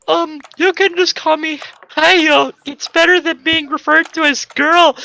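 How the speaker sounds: put-on high, shy girl voice. Girl asking to be called Hayo, high and bashful. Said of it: "she said".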